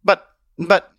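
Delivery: high, loud stammered protest; sputtering. Acted. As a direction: stumble on the first word, flustered.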